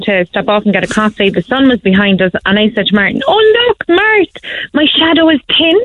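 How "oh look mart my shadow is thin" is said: high-pitched retold excitement; delighted. Wife showing Martin her thin shadow, delighted. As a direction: call out a thrilling discovery, pitched well up.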